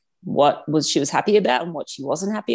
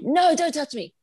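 imitating child's tantrum; 10 semitones higher than usual. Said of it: "she's crying".

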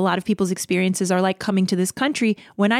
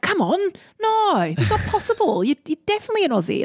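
put-on Australian accent; 10 semitones higher than usual. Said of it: "they're always like".

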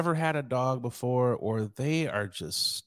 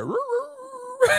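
very high, far-off dog howl; squeaky mock dog roo-roo; slower than usual. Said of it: "It was like".

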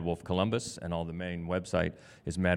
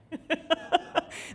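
laugh; 3 dB quieter than usual. Real, not acted. Host laughs at the trailer.

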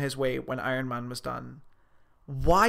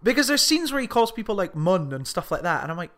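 normal talking voice

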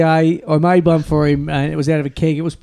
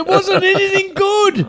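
much higher and slow; high mock lament; 13 semitones higher, slower than usual. Joking the rubbish was worthless, high whine. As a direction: whine it out like a let-down.

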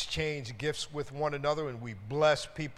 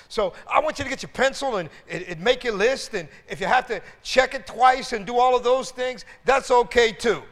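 preaching; 5 semitones higher, 10 dB louder than usual. Acted. Preaching to the congregation.

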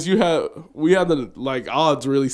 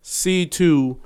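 normal talking voice

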